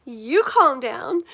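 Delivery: slow, loud mock comeback; playful slow "you calm down"; mock indignant retort. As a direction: toss it back, teasing.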